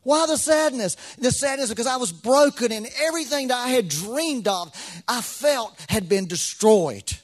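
loud, high, driving sermon voice, slowing down at the end; full-force preaching. Acted. Pastor preaching hard to the church about sadness, loud and high. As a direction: preach it out to the congregation.

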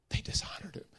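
hushed mock-crying whisper; acted tearful upset. Weeping woman upset for Jesus, acted tearful whisper. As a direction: whisper it as if through tears. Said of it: "And she's crying".

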